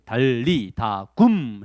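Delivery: "달리다 굼" spelled out very slowly; slow repeat. Shown as a demonstration. 선생님이 아이들에게 천천히 따라하게. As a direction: drawl each syllable of "달리다 굼". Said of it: "했어요".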